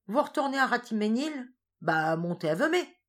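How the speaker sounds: lightly voiced farmer's invitation; country farmer. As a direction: offer the ride offhandedly. Farin offers a ride.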